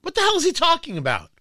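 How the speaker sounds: a sudden squeak of stunned disbelief; incredulous outburst. Real, not acted. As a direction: fire it off in shocked disbelief. Reacting to a baffling clip.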